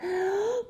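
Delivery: sharp intake of breath; startled gasp. Acted. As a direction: gasp in surprise. Boy sees the bow.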